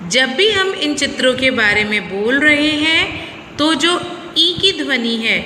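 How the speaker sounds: normal talking voice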